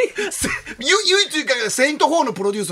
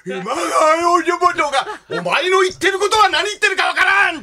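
興奮して声を張り上げた老人役; 熱が入って叫ぶように; 大声の物真似; 9 semitones higher, 4 dB louder, slower than usual. Retold or imitated. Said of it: "声がでかい、声がでかい". (興奮した大声の真似)

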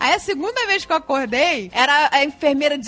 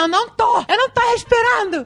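higher, imitated panicked insistence; mock panic; 8 semitones higher than usual. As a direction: insist you can't breathe, half in jest. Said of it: "eu comecei a gritar". (insistência em pânico imitada)

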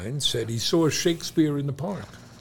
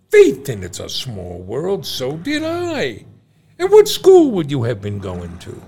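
put-on Irish accent; 6 dB louder than usual. Irish bar joke.

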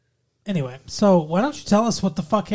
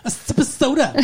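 laughing; 7 semitones higher than usual. Real, not acted. Echoing with a laugh.

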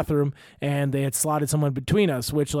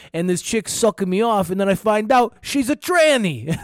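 Dice Clay delivery; 6 semitones higher, 4 dB louder than usual. Retold or imitated. Comedian imitating a bad act's Dice Clay style. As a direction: ham up a crude stand-up punchline. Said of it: "he delivers the joke like that, like Dice Clay".

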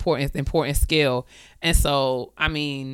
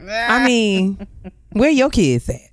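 high, loud mock retort; sassy comeback; 6 semitones higher, 3 dB louder than usual. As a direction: put them in their place. Retorting to a nosy elder.

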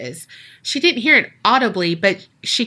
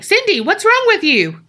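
high, loudish mock concern; high-pitched imitation calling to her; 10 semitones higher, 4 dB louder than usual. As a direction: voice him comically high. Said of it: "he got a deeper voice that time".

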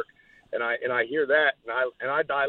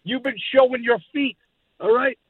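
brash trash-talking promo; cocky heel promo; wrestling promo; 5 semitones higher than usual. Acted. Enzo cutting a promo.

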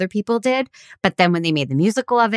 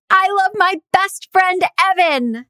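mock rooftop scream; 13 semitones higher than usual. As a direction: belt it like shouting from a rooftop. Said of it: "I wanna go to the rooftop and scream".

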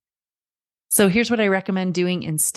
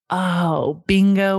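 ordinary talk, nothing marked

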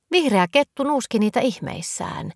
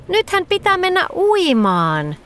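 excited squeal; 10 semitones higher than usual. A narrator's reading. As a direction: cheer it out, pitched right up. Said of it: "Nelli hihkui".

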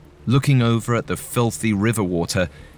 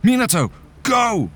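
urgent shout; 12 semitones higher than usual. A narrator's reading. Catcher urging his friend to flee, urgent shout. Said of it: "he shouted".

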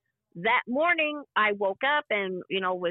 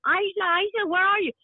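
husband screaming her name; 9 semitones higher than usual. Retold or imitated. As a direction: call out for her like a frightened husband. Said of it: "I hear my husband screaming my name".

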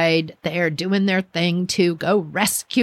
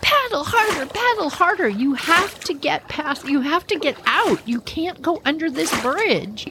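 urgent coaching; 8 semitones higher than usual. Retold or imitated. Urging the last canoer from bridge.

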